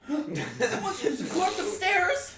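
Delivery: panicked. Acted. NPC women panicking at corpse.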